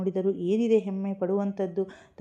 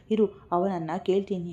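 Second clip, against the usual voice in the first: ordinary delivery, nothing marked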